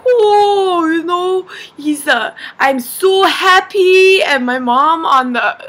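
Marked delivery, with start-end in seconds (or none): 0.1-4.2 high mock-gushing delight; happy dad impression, swelling louder; imitated elated dad. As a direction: gush like an overjoyed dad, stretching the end. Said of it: "my dad is like".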